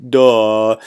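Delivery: loud, teasing duh, as if it were obvious; mock duh. Real, not acted. Teacher teasing students about the obvious point, a loud mock duh. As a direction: toss it off like it's too obvious to say.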